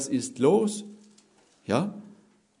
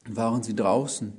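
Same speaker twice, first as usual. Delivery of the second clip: ordinary talk, nothing marked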